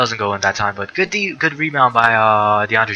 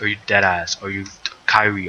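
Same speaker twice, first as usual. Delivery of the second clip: ordinary talk, nothing marked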